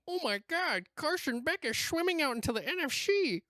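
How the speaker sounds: high, fast cartoon fish voice; squeaky fish with buck teeth. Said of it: "I said it like the fish".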